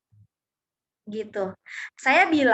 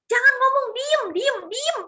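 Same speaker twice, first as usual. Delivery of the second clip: high-pitched, repeated command; acted insistent command, pitched well up; insistent hushing order; 10 semitones higher than usual. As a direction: order silence in a raised, urgent pitch. Voicing the Holy Spirit's order.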